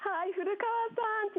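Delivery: excited greeting. Real, not acted. Greeting astronaut live on air.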